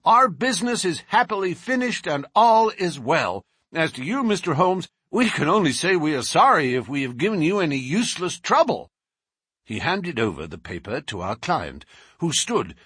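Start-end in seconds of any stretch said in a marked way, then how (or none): 0.0-8.9 breeze in with good news; brash American. A narrator's reading. Lawyer congratulating the collector and Holmes, brash.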